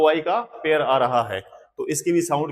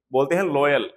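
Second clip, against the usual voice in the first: ordinary talk, nothing marked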